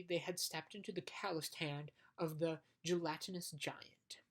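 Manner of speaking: usual speaking voice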